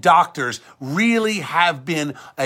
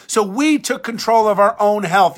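usual speaking voice

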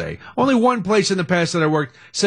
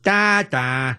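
goofy mock Chicago accent; hammed-up Chicago fan accent; 4 semitones lower, slower than usual. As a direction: imitate a comedy-sketch Chicago accent. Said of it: "used to do the Chicago accent".

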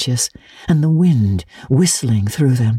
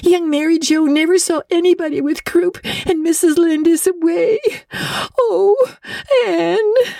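much higher, tearful and broken; sobbing. Acted. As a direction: cry the words out in despair. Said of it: "sobbed Diana".

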